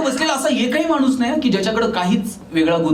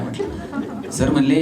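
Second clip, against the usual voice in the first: ordinary talk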